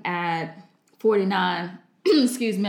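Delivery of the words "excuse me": clearing a scratchy throat. Real, not acted. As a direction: clear the throat, then excuse yourself. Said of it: "I've got something in my throat today".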